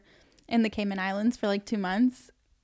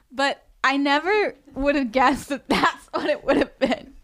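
ordinary talk, nothing marked

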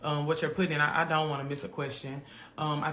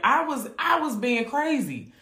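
emphatic; 9 semitones higher, 5 dB louder, slower than usual. Real, not acted. Admitting past behavior.

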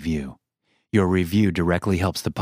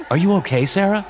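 high, worried call to the wife; anxious raised call; crying out; 12 semitones higher, 3 dB louder than usual. A narrator's reading. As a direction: call out with rising worry. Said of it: "I cried out to her".

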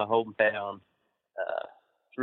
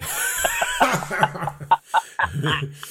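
high real laugh; 8 semitones higher than usual. Real, not acted. [name] laughing at his own Sheik story. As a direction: laugh at the memory.

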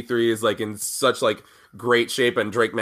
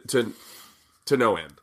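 ordinary talk, nothing marked

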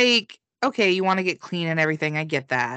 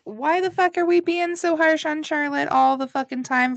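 higher-pitched exasperated mock complaint; mock exasperation; 8 semitones higher than usual. Co-host voices the complaint about harshness on Charlotte, mock exasperated. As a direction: protest in a raised, incredulous voice. Mimics the prevailing complaint vibe.

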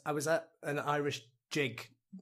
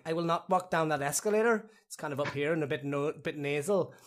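raised, nasal Northern Irish accent; 4 semitones higher than usual. Shown as a demonstration. Actor touring UK accents for the hosts, nasal Northern Irish. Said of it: "if we go to Northern Ireland".

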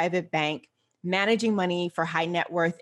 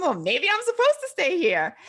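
high-pitched retelling of her own thought; lightly acted, high inner voice; mock second-guessing; 10 semitones higher than usual. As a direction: voice your past self wondering, pitched way up.

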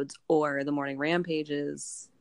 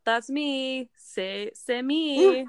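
slow, higher hammed-up fake French accent; 8 semitones higher, 4 dB louder, much slower than usual. The phony designer introduces himself, in a hammed-up fake French accent. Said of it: "a fake French accent every now and then".

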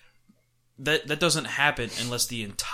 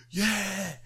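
pumped taunt; 10 semitones higher, much slower than usual. Retold or imitated. Little brother taunting a rival fan, high and jeering. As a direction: gloat at the opposing fan. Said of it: "he's just like".

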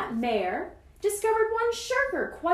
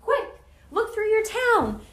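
loud, high urging; urgent command; 8 semitones higher, 6 dB louder than usual. A narrator's reading. Horton sending the mayor through town, urgent loud call. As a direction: press the mayor hard, voice raised.

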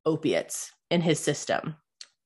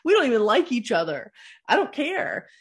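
mock fed-up retort; 6 semitones higher, 3 dB louder than usual. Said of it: "vividly remember that feeling of".

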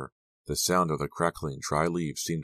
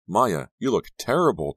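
higher, louder blurted cry; a startled exclamation; cried out; 5 semitones higher, 6 dB louder than usual. A narrator's reading. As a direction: blurt it out in alarm. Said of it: "Della cried".

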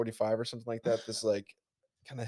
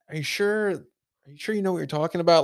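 high, quick, doubting cashier voice; mock the cashier's doubt, pitched up; 7 semitones higher, 5 dB louder than usual. The cashier doubting him at the register, mimicked high and quick.

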